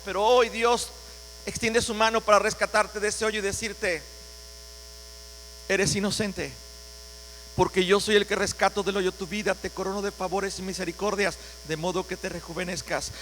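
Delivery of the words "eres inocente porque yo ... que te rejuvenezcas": tender reassurance. Acted. God speaking tenderly to believer.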